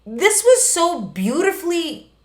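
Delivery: high-pitched, drawn-out gushing; mock hysterical crying. Viewer moved by the cartoon, mock hysterical crying. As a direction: wail it in exaggerated emotion. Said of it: "crying hysterically".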